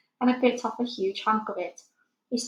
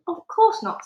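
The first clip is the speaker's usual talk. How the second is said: put-on much higher, a small girl correcting gently; little girl Sophie; 9 semitones higher, 7 dB louder than usual. A narrator's reading. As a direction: lift the voice high like a child. Sophie insisting.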